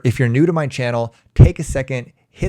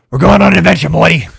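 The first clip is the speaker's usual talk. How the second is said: loud, higher cartoon scientist voice; Rick Sanchez; 5 semitones higher, 11 dB louder, much faster than usual. Shown as a demonstration. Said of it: "sounds like Rick Sanchez from Rick and Morty".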